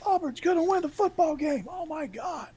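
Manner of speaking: shrill high mocking echo. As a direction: parrot the jeer in a high squeak.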